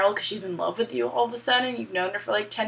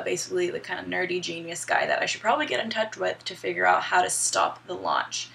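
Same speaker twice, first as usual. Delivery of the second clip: ordinary talk, nothing marked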